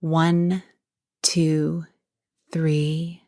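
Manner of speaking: measured breathing guide with long pauses; guided meditation. Real, not acted. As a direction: count them gently through each breath, unhurried.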